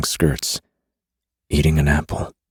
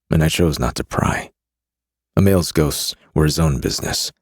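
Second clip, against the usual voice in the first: usual speaking voice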